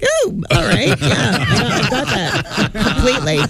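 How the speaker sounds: high laughing mock agreement, slowing down; fake-eager yes, clearly lost; sarcastic agreement. Mock agreement to odd direction.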